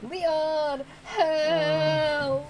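high shouted call for help; panicked cry for help. Pretend. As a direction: yell the name like you need rescue. Calling out for help again.

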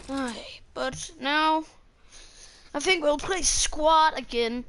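ordinary talk

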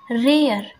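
रेयर gliding from ए into अ; air sound in rare. Shown as a demonstration. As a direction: slide ए to अ in रेयर. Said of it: "ए और अ आ रहा है साथ में". (रेयर में ए से अ)

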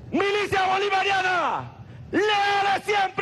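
shouted rally chant, pitched high; ranting dictator speech. Real, not acted. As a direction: bellow each slogan to the crowd. (arenga gritada a todo pulmón)

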